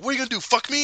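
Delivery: high-pitched acted taunt; mock challenge. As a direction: dare them mid-squabble. Acting out bedroom fight quarrel.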